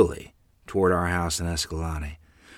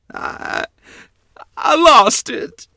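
high, loud, halting wail; stammer, then cry it out slowly; distraught confession; 10 dB louder, much slower than usual. Acted. Child confesses the lost dollar to mother, distraught. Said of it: "I ran to the bedroom crying".

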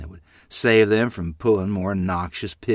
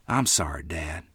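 soft, lower, sheepish apology; quietly; 6 semitones lower, 8 dB quieter than usual. Acted. Boy confessing to his father, quiet. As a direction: say it small and ashamed. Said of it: "Vay said quietly".

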